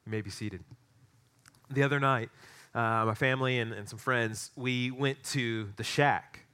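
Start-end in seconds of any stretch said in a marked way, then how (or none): none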